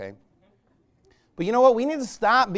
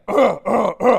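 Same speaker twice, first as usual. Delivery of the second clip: imitating three short grunts; three gruff mock grunts; Tim the Toolman grunts; 7 dB louder than usual. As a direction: grunt like a TV handyman, loud and short. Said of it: "Tim the Toolman would give us three grunts".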